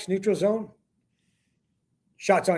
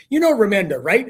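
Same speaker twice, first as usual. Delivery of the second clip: higher, louder retold griping; pissed off; 4 semitones higher, 6 dB louder than usual. Annoyed colleague complaining to his wife by phone, pissed off. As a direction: imitate a fed-up colleague venting about someone. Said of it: "now he's pissed off".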